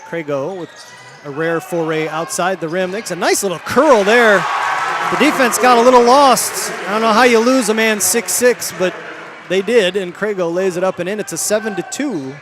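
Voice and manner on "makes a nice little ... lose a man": excited. Real, not acted. Broadcaster reacts to open layup.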